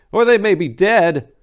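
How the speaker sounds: high, loud fearful monk voice; fretting. A narrator's reading. Scar-faced monk fearing the brothers are dead, fretful. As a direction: fret out the worst outcome. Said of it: "joined in to fret".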